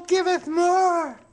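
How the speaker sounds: lightly voiced, slow pleading fan; voicing eager readers. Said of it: "they'd be like".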